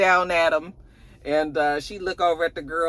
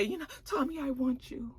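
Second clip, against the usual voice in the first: much softer, intimate woman; seductive; 8 semitones higher, 12 dB quieter than usual. Retold or imitated. Maria seducing Tommy, much softer. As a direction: breathe it to him softly.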